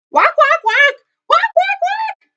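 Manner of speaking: frantic-fast, piercingly high goose quacks; very loud shouting. A narrator's reading. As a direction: squawk as loud as a goose can. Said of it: "Katerina shouted very loudly".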